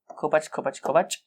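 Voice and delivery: imitated sound. Imitating a stone being tied.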